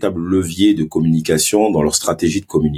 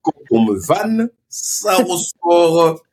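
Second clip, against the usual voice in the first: put-on Marseille accent; 11 semitones higher, much slower than usual.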